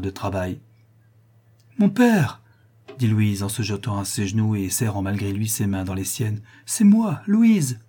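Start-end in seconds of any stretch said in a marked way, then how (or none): 1.8-2.4 high, loud cry of anguish; distraught call. A narrator's reading. Daughter kneeling to her mad father, distraught call. 6.7-7.8 high, loud, distraught cry; desperate appeal. A narrator's reading. Daughter clutching her father's hands, desperate appeal.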